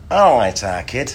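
full-on Manchester singer impression. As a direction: go all in on a cocky Mancunian voice.

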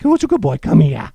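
high, loud sing-song coax to a dog; loud high pet-talk to a stray dog. Retold or imitated. Calling the dog on the path.

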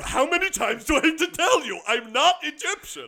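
high, loud, fast protest; mock exasperation. Protesting being called Egyptian, mock-exasperated outburst. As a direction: blurt it out in fake outrage.

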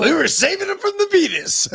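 "penis" as a high-pitched, mangled beetus; clipped diabetes. Acted. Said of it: "it sounded like penis".

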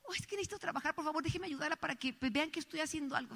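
vocecita baja de anciana; viejita suplicante. Acted. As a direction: ruega muy bajito, como una viejita. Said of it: "llega una viejita". (súplica suave y aguda de anciana)

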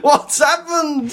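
high, slow mock shout of alarm; a friend yelping in fright on the phone; alarmed cry. Friend on the phone hearing bad news, high alarmed cry. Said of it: "She goes".

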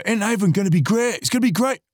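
high-pitched excited mock voice; imitating Dave Clark. Host mimics a late friend's joy about heaven, high imitation. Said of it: "he'd always pipe on and just go".

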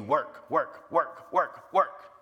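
building, demanding chant of work; a wolf pushing works, rising insistence. Retold or imitated. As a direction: drive it harder each time, a lightly voiced wolf. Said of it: "He provides the voice of a wolf".

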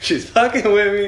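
high, loud, drawn-out mock outcry; hammed-up alarm at being toyed with.

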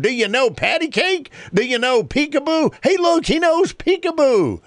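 high, sing-song call across the room; old man shouting. Acted. Drunk old man calls to the baby across the restaurant, high and sing-song. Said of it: "the man began shouting across the room".

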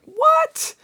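a sudden very high yelp of disbelief; shocked what. Retold or imitated. Friend retelling a TV moment, shocked high squeal.

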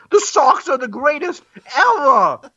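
high, hammed-up Boston fan chant; terrible Boston accent. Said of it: "That's a terrible Boston accent".

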